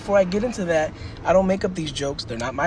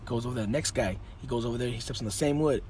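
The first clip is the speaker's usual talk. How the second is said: normal talking voice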